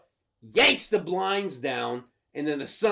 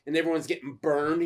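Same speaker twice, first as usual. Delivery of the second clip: ordinary talk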